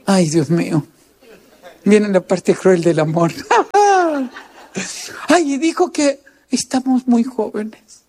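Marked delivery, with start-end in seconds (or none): 3.4-4.3 risa aguda y breve, cayendo al final. Real, not acted. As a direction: suelta una risita alta y corta. Risa ante la parte cruel. (risa aguda al contar la parte cruel del amor)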